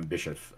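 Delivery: Bischoff with SH and a weak ending, "BISH-uff"; SH pronunciation. Shown as a demonstration. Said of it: "I don't know how you say his last name".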